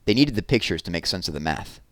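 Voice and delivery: ordinary delivery, nothing marked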